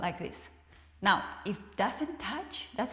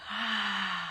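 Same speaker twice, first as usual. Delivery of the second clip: voiced sigh. Shown as a demonstration. Teacher shows students the releasing sigh, voiced. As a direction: open voiced "Ah" on the out breath. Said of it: "And then say".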